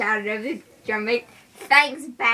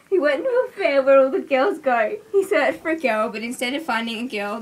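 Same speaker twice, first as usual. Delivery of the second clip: usual speaking voice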